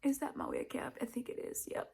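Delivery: whispered aside to herself. Real, not acted. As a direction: whisper it as if caught out. Whispered aside about wig cap.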